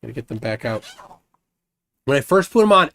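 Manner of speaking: ordinary talk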